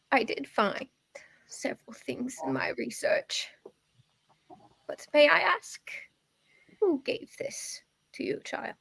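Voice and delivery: put-on soft, whispery old woman; old lady voice. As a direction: murmur like a wise crone. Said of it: "my old lady voice".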